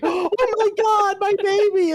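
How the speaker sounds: mock frantic mother. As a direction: squeal it like a panicked mom. Invisible Girl finding baby.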